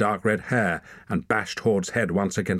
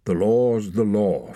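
firm, louder ruling; brisk; 3 semitones higher, 5 dB louder, slower than usual. A narrator's reading. Leader rules that the boy won, brisk. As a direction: state it as settled. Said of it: "Finkedin said briskly".

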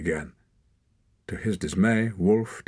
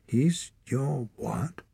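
slow, higher-pitched disbelief; suspicious; 7 semitones higher, much slower than usual. A narrator's reading. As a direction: question it slowly, suspiciously. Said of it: "threw him a suspicious look".

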